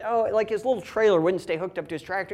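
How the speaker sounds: usual speaking voice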